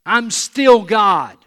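loud, high, drawn-out declaration; emphatic proclamation. Acted. Preacher speaks as God to Elijah, emphatic proclamation. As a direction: proclaim it slowly at full voice.

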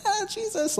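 mock squeal way up in falsetto. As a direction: squeal it like a frightened child. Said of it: "in falsetto voices, like little babies".

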